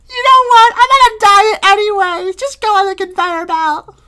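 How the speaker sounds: very high, loud cartoon voice shrugging it off; breezy Screen Lady. Screen Lady gives up on her friend, high carefree voice.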